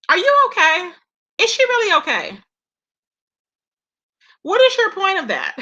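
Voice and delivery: incredulous call-out; indignant disbelief. Real, not acted. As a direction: challenge her, are you serious. Indignant questions at Trish.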